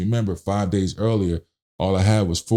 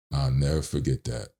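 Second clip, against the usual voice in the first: ordinary delivery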